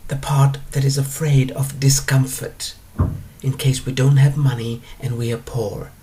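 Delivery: ordinary delivery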